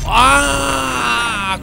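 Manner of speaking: sharp high yell on the attack; battle cry. Real, not acted. Gamer striking an enemy, sharp battle yell. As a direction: let out a short fighting shout.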